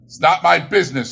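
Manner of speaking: irritated Scrooge. Acted. Scrooge snapping at the charity gentlemen, irritated. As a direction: shut the topic down.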